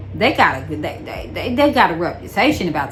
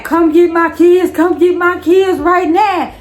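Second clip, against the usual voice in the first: higher, louder desperate plea; retold frantic plea; 8 semitones higher, 5 dB louder than usual. Mother begging her friend on the phone to fetch her kids, frantic. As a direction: beg urgently like you're crying on the phone. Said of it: "and I'm crying".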